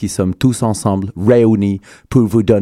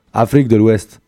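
usual speaking voice

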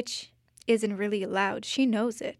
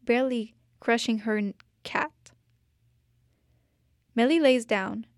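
usual speaking voice

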